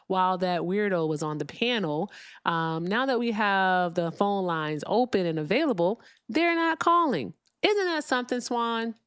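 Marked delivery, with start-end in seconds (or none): 6.3-8.9 much higher, slowed, mock-amazed; high sarcastic sing-song to the co-host. Real, not acted. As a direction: put on fake astonishment for Swan.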